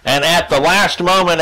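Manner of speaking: ordinary talk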